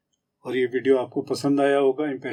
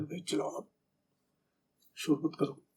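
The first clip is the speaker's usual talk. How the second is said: whispered, much softer hush; hushed warning; 3 semitones higher, 11 dB quieter than usual. Shown as a demonstration. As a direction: hiss it quietly while sneaking. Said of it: "कहीं छुप के जा रहे हो तो कोई जोर जोर से बोले".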